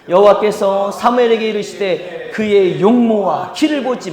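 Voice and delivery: loud, measured Bible reading; scripture declamation. Real, not acted. Preacher leading congregation through the verse, loud declamation. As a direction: proclaim each phrase firmly for all to follow.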